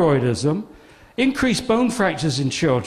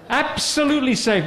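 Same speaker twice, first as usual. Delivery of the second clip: mock reassurance; 8 semitones higher than usual.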